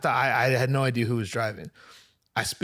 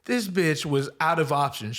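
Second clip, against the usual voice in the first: normal talking voice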